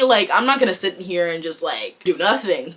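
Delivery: normal talking voice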